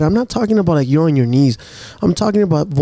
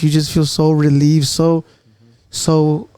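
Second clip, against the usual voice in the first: ordinary talk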